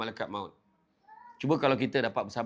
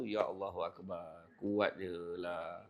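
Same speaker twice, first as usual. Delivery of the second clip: soft, slow, lower awe; awed hush; 6 semitones lower, 9 dB quieter, slower than usual. Real, not acted. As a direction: breathe it out in wonder. Awe at Khadijah's strength. (perlahan, rendah, penuh kagum)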